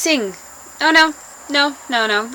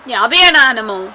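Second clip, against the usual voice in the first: usual speaking voice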